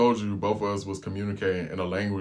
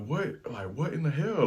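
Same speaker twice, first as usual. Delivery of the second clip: high and hushed, can't believe it; baffled disbelief; 6 semitones higher, 5 dB quieter than usual. Retold or imitated. Retelling his baffled reaction to the gas request, high and hushed. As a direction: pitch jumps high, then softer.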